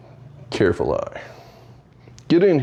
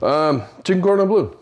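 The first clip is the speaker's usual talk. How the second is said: a deliberately bad Scottish accent on "chicken cordon bleu", a bit higher; worst Connery accent; 6 semitones higher than usual. Cooking host names his dish for viewers in a bad Scottish accent. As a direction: ham up a terrible Scottish film-spy accent. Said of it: "with the worst Connery accent ever".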